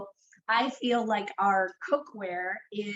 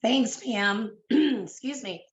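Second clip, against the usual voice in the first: ordinary talk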